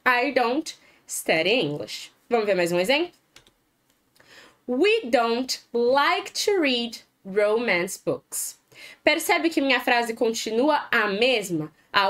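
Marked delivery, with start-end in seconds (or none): 0.0-2.1 slow model sentence. Shown as a demonstration. Teacher models English sentence. 4.7-8.6 slow, careful classroom English; slow model sentence. Shown as a demonstration. Teacher modelling negative sentence, slow and careful.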